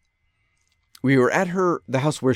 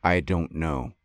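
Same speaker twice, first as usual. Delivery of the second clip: low and quiet; 10 semitones lower, 4 dB quieter, slower than usual. Shown as a demonstration. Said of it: "You can say".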